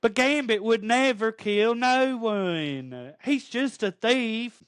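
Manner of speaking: high, slow, drawn-out mock plea; hammed-up wail in a lover's defense; Rogue. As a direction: stretch every word out high, as a mock-pleading impression. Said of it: "Speaking of Rogue".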